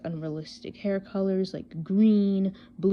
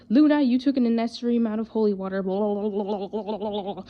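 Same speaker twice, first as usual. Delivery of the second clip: loud, rushed mock scolding; April's nagging; 3 semitones higher, 5 dB louder, faster than usual. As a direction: mimic a nag, higher and faster, dissolving into wobbly blah-blah. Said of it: "April decided to start nagging me".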